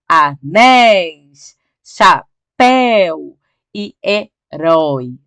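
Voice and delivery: "anéis, chapéu e herói" very slow, each final open diphthong stretched; stressed open diphthongs. Shown as a demonstration. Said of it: "Todas em ditongo aberto".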